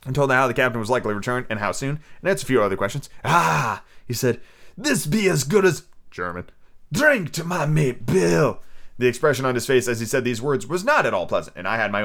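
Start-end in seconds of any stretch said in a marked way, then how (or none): 3.2-3.8 put-on a touch of old salt growl; pirate stranger. Stranger greeting the boy, lightly piratey. 4.8-8.6 put-on higher, slow old sea dog; sly pirate. Stranger toasting his mate Bill, slow pirate voice.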